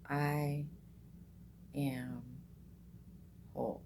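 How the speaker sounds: each word drawn out with pauses; slow mantra. Shown as a demonstration. Guiding meditators through the mantra, slow and soft. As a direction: let each word settle before the next.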